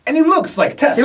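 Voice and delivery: high-pitched, excited outburst; excited exclamation. Real, not acted. Telling viewers about the friend's resemblance, excitedly.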